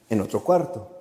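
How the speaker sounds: normal talking voice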